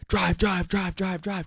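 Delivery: high urgent shouts of drive; frantic yelling. Retold or imitated. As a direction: yell at him to go. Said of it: "screaming at Beef".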